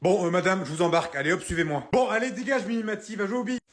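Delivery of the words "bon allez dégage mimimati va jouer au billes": dismissive shooing. Acted. Dismisses the boy impatiently.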